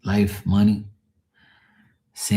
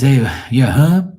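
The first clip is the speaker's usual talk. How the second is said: drawn-out, louder, higher dad question; imitating dad; 6 semitones higher, 8 dB louder, slower than usual. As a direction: holler it slowly like a dad checking in. Acts out dad checking on him.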